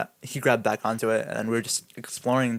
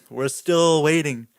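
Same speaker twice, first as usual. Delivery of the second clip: "still" stretched long and raised, weary refrain; drawn-out STILL; 3 semitones higher, slower than usual. Retold or imitated. Skydiver recounting the endless wait, STILL drawn out. Last of escalating waiting refrain.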